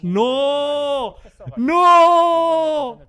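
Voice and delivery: long, high, loud drawn-out cry; real shocked scream. Real, not acted. Streamer floored by surprise update news, screaming in shock. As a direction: wail each word out high and long in disbelief. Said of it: "yo me quedé completamente loco porque no me lo esperaba".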